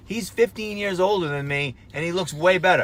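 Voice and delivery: complaining in self-mocking outrage; worked-up rant. Real, not acted.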